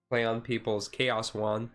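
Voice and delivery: usual speaking voice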